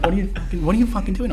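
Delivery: mock high wife voice, rising in pitch; wife yelling. Wife yelling at husband over the door, high mock voice. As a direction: imitate the exasperated wife shrieking.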